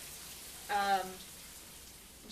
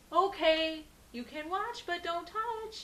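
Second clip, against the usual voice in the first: grandparents' reply; 10 semitones higher, 4 dB louder, slower than usual. Retold or imitated. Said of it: "And they say".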